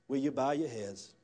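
ordinary talk

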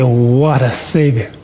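"What a Savior" slow and marvelling, with a small laugh; slowed, awestruck "What a Savior" with a light laugh; amazed. Shown as a demonstration. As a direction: let "What a Savior" fall in slow wonder. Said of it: "you're so amazed at he".

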